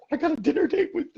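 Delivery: high-pitched, hammed-up thrill; mock giddy excitement. Actor invited out by the director, giddy mock excitement. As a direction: gush it high with a laugh.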